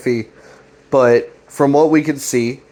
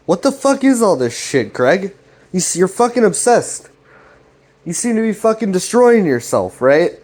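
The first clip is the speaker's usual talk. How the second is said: high, exasperated retold scolding; angry accusation; 7 semitones higher than usual. Jeff telling Greg he is obsessed, acted exasperation. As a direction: act out a brother telling him off, pitched up.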